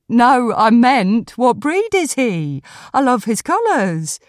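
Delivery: clarify brightly as the admiring woman; amused dog walker. Acted.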